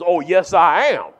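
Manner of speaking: defiant shout. Retold or imitated. Traveller defying security.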